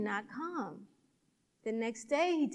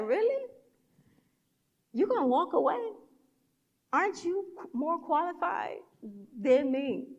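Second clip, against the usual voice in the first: higher-pitched, disbelieving questions; incredulous challenge; 4 semitones higher than usual. Retold or imitated. Challenging the lady who walked away, incredulous. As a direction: call them out in rising disbelief.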